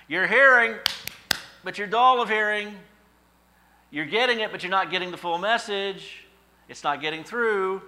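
high, loud rebuke; smack in the face. Retold or imitated. Preacher giving the church a loud wake-up rebuke. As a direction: jolt them awake, loud and high. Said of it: "maybe it's a smack in the face".

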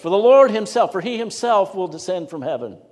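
"Lord himself" high and loud, easing afterwards; emphatic proclamation. Real, not acted. Preacher proclaiming the verse to the congregation, forceful emphasis. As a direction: hit "Lord himself" hard, then settle slightly. Climactic requote stressing himself.

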